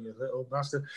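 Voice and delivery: low, soft laughing mutter; father cursing his son quietly; muttered curse. Retold or imitated. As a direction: grumble it under your breath, half laughing. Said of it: "And I'm going".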